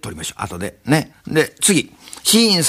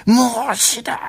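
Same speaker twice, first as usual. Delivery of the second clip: mock dying; 9 semitones higher, slower than usual. Shown as a demonstration. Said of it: "俺が断末魔やってどうすんねんな".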